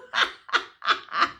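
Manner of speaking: put-on wheezy breathless cackle of an old man; old rooster cackle. A narrator's reading. Old rancher laughing at the young cowhand, cackling. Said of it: "He cackles like an old rooster".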